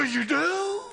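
high, loud surprised friend; a friend amazed he has the way; surprised question. Retold or imitated. Buddhist friend asks, high and surprised. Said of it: "They go".

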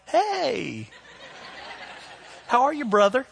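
high, drawn-out sweet hello; mock-friendly greeting. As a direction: switch to a sugary churchgoer's greeting. Switches to friendly church greeting.

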